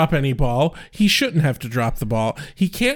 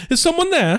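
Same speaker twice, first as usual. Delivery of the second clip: pitched-up wary question; high nervous Giles. Retold or imitated. Giles returning to the empty room, high wary call. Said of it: "he's like".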